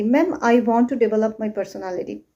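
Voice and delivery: fast learner's recital, every word run together; no stress. Retold or imitated. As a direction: hurry through it with nothing emphasised. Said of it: "There is no stress".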